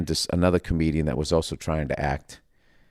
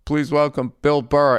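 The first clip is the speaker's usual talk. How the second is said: class host announcing; 5 semitones higher than usual. Retold or imitated. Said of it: "And they go".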